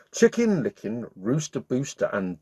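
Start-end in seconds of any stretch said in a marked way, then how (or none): none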